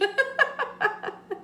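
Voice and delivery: high-pitched laugh; bursting into a high laugh. Real, not acted. Laughing at own joke.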